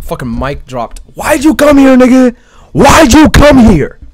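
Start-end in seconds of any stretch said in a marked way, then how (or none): 1.1-4.0 much higher, loud, slow yell; over-the-top screaming taunt; hammed-up shout as Grimmjow. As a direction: belt the challenge out, drawn out. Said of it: "That was, that was the thought".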